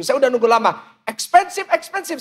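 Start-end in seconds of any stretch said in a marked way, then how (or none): 1.1-2.2 high-pitched annoyed echo; exasperated, voice raised in pitch; irritated. Retold or imitated. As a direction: repeat it in mock disbelief, pitched up. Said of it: "Saya jengkel denger gitu".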